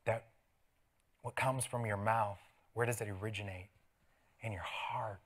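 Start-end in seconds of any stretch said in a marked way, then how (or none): 4.4-5.2 hushed, softer whisper. Acted. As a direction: lean in and breathe it like a secret. Preacher answers his own question.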